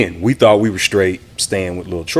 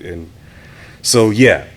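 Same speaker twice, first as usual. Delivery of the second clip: ordinary delivery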